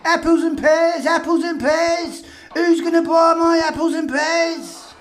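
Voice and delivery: hammed-up Barrow Boy accent calling out wares. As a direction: shout it like a Cockney barrow boy selling fruit. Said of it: "In his Barrow Boy accent".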